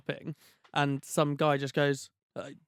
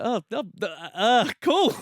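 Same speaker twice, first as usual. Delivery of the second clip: awkward hesitation; 7 semitones higher, 5 dB louder, slower than usual. Retold or imitated. Awkward moment in lockdown.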